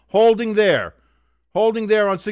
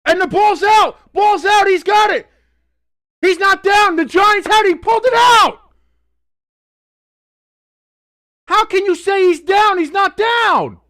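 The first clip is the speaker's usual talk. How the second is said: excited shout, higher and louder; real shouting; 9 semitones higher, 5 dB louder than usual. Real, not acted. Fan yelling at the screen that the runner wasn't down. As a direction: yell it like the fumble is yours.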